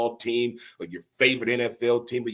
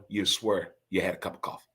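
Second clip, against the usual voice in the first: usual speaking voice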